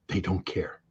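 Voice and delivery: whispered under the breath. Real, not acted. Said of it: "whispered aside".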